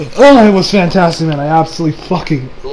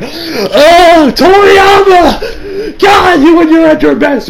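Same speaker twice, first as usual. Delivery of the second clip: much higher, louder wail with a sob; mock sobbing cry-out; 10 semitones higher, 4 dB louder, much slower than usual. Fan crying out to Toriyama, higher and louder. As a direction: wail the name like an overwhelmed fan. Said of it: "and I'm just like".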